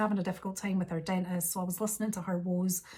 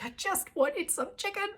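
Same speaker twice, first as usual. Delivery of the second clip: much higher, pitiful whine; whiny complaint; 11 semitones higher than usual. Acted. Telling Billy about her failed shopping, high whine. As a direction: squeak it out like a sulky child.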